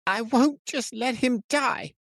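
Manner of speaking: high, slow desperate cry near tears; fighting back tears as the voice breaks; voice cracking. A narrator's reading. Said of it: "Tobin's voice cracked".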